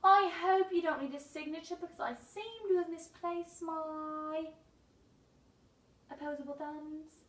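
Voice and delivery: high, loud British greeting; British accent, chirpy and proper. Acted. Raccoon greeting the mailman in a British accent. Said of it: "say in a British voice".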